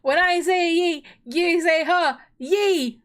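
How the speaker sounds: chanted crowd call; call and response. Pretend. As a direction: lead a playful yee-haw chant. Leading the yee-haw chant again.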